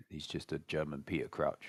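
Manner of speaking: usual speaking voice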